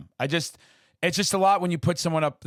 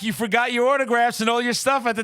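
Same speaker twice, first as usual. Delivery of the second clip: high, loud calling-out voice of Elvira; calling after him; 9 semitones higher, 4 dB louder than usual. Retold or imitated. Elvira calling the fan back to the table, high and loud. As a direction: call across the room to a little boy. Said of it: "five million people".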